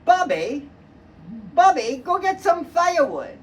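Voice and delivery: mock mother's holler; mother calling her son. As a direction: call out like a bossy mother.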